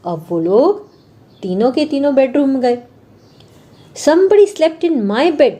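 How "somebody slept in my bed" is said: higher, slow mock shout of papa bear; papa bear hamming up his anger; big gruff voice. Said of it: "shouted Papa Bear in his big gruff voice". (पापा भालू की नाटकीय ज़ोरदार आवाज़)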